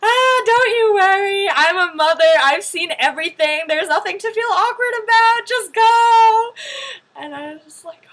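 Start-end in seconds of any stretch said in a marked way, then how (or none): 0.0-6.5 high, loud, insistent reassurance; hammed-up motherly hollering; hurrying ajumma. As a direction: imitate a no-nonsense ajumma brushing off embarrassment. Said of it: "she was hurrying so much".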